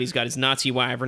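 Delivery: normal talking voice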